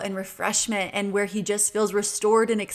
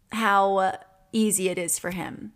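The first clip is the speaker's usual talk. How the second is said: normal talking voice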